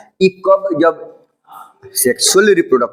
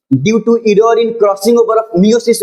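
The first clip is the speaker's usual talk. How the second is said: slow dictation; 6 semitones higher, much slower than usual. Acted. Dictating a note.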